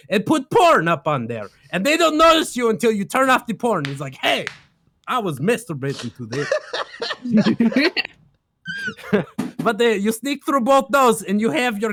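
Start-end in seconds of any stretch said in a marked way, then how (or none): 4.2-7.9 slow, giggly guard voice; laughing mock quote. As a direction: drag it out while cracking up.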